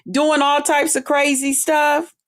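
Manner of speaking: ordinary delivery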